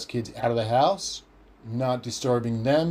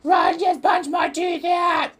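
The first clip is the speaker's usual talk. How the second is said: much higher, louder slow yell; 1 shouting sister; 5 dB louder than usual. Retold or imitated. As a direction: wail it out to the whole house. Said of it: "Natasha's like".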